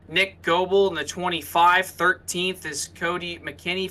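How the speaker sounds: ordinary talk, nothing marked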